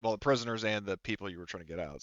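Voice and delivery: ordinary talk, nothing marked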